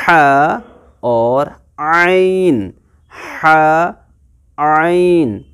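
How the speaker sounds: ह and आइन drawn slowly from the middle of the throat, then repeated; tajweed letter demo. Shown as a demonstration. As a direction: stretch out ह and आइन, then say them again. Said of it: "जैसे आप पढ़ते हैं".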